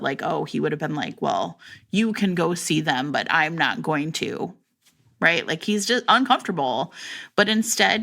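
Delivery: usual speaking voice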